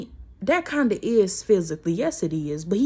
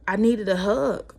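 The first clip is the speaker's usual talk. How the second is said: ordinary talk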